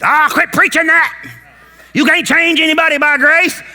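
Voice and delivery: high, scoffing dismissive voice; mocking a religious critic. Preacher mimics critics scoffing at grace, mocking.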